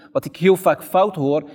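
ordinary talk, nothing marked

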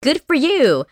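high-pitched imitated huffy comeback; mock defiant retort. Assistant quits on the scolding doctor, mock defiant. Janet retorts in retold quarrel.

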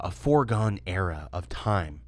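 normal talking voice

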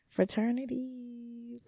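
slow, soft "Fraternity" sounded out syllable by syllable; quiet, halting try at "Fraternity"; careful pronunciation. Shown as a demonstration. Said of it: "I don't think I'm saying the word right".

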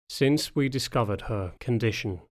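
plain reading voice

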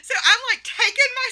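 high squealing laugh; cracking up mid-sentence; laughing hard. Real, not acted. Recalling the scene, cracking up.

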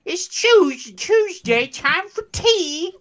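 high mock British, slow on time for tea; mock British accent. Mocking the British teatime, high mock British accent. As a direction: drawl time for tea like a stuffy Brit.

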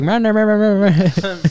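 teasing nonsense imitation; mocking gibberish. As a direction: mimic them with silly meh noises. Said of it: "they're like".

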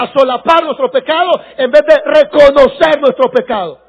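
fired-up pastor shouting at the congregation, drawing out key words; angry preaching. Real, not acted. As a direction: preach at full force, loud and high, building to a shout. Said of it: "Ya se enojó el pastor". (prédica enojada, a todo pulmón)

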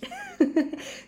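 high-pitched giddy laugh. Real, not acted. Podcaster hyping her class date, laughing. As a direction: laugh high after the hype.

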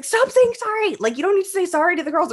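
imitating scolding mom. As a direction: fire it off like an exasperated mom. Mom scolding daughter on the field.

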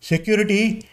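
usual speaking voice

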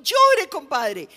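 loud, high, drawn-out yell; shouted calling out. Acted. Friend on the pier to drowning compadre, calling out loudly. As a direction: call out to someone down in the water. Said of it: "el que estaba en el puente le gritaba".

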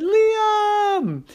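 high, loud joyful cry; joyful call. Acted. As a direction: call his name in delight. Parents call out.

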